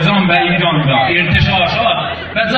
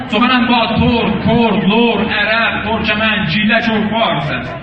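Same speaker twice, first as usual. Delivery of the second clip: rally speech; 4 semitones higher than usual. Real, not acted. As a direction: proclaim it to the crowd. Speech climax.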